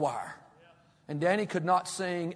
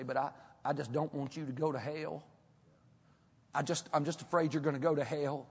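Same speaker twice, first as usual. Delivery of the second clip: lightly imitated tearful plea; soft, lower, halting concern; Keith Dale; 4 semitones lower, 8 dB quieter than usual. Soul winner pleading with a stranger, mildly tearful imitation. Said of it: "he'll start crying".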